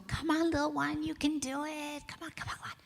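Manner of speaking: high, soft, tender coaxing that fades away; coaxing a baby. Retold or imitated. Encouraging a baby trying to stand, high and soft. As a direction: cheer a toddler's first step gently. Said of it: "And of course you'd go".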